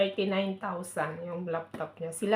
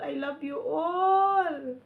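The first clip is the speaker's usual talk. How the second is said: tearful; 4 semitones higher, much slower than usual. Real, not acted. Emotional thanks to employers.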